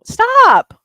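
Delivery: sudden high, loud yell; real scolding shout. Real, not acted. Owner to cat still eating, high scolding shout. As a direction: shout it to halt the cat at once.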